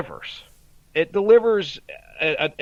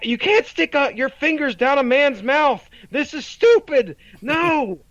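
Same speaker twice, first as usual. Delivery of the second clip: much higher mock child voice, indignant; high, whiny kid impression; imitating upset kid; 9 semitones higher, 3 dB louder than usual. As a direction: play the outraged little son protesting. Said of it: "He's like".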